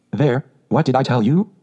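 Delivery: usual speaking voice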